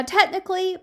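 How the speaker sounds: plain reading voice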